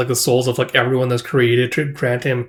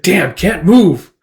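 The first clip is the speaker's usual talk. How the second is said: straining, stuck; 6 semitones higher, 6 dB louder, slower than usual. Acted. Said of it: "It's like".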